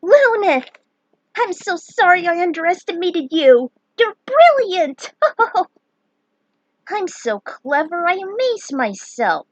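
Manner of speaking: shrieking high delight, laughing; agog. Acted. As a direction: gush with over-the-top praise. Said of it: "Prish was all agog".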